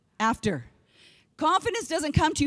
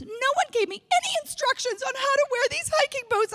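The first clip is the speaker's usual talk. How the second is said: high, whining mock complaint; whiny sister; 12 semitones higher than usual. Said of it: "she actually said this".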